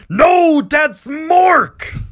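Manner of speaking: very high, loud orc roar; orc yell. Acted. Another orc chops the boy down, furious yell. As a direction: roar the correction over him. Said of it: "immediately and yell".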